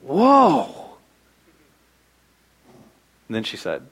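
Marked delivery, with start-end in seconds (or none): 0.0-0.8 put-on child's amazed whoa. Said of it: "And she went".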